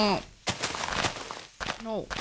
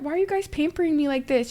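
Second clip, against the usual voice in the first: normal talking voice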